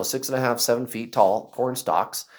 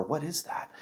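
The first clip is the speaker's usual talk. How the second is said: very soft, hushed question; really quiet; 2 semitones higher, 13 dB quieter than usual. Retold or imitated. Girl asking what the figure is, really quiet. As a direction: murmur it under your breath. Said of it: "like, really quiet".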